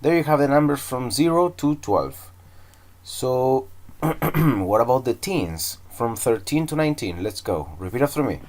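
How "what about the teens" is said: normal talking voice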